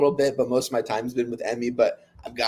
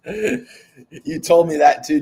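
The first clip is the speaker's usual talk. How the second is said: slow, loud, laughing through the words; 5 semitones higher, 8 dB louder, much slower than usual. Real, not acted. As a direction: laugh out the line. Laughing at a retold story.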